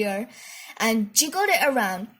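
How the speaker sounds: ordinary delivery, nothing marked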